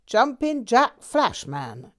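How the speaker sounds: a beaming, drawn-out boast; gleeful grin. A narrator's reading. Old friend announcing his new name, gleefully. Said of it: "he grinned with unbridled glee".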